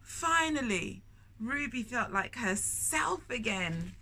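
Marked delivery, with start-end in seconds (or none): none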